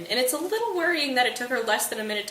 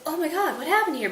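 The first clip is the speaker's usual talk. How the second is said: higher-pitched mock shock; shocked prince; 7 semitones higher than usual. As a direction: play at being appalled. Prince finds corpses in the street.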